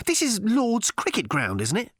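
high, agitated snapping; snapped. A narrator's reading. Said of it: "snapped Arthur".

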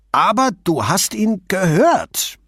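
pushing the barman to admit it, insistently; insisting. A narrator's reading. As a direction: press the point stubbornly. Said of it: "beharrte Nino".

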